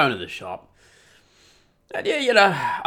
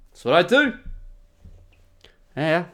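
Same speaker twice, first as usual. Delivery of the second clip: ordinary talk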